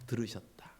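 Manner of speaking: hushed emphasis on the meaning; whispered. Shown as a demonstration. Pastor hushing the meaning of Simeon's name in a whisper. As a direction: whisper the word softly. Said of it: "시므온이란 뜻은 들으셨다라는 뜻".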